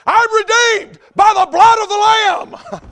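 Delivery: high, drawn-out full-voiced shout of praise; exultant shout. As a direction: shout it slowly to the whole congregation. Acts out convert shouting his praise.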